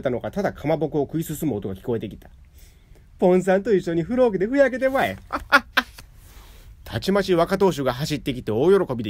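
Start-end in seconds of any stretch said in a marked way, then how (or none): 3.2-5.2 triumphant jeer. A narrator's reading. Wife throws man away. 5.2-6.0 high cackle of victory; triumphant laugh. A narrator's reading. Wife laughs high after hurling husband away.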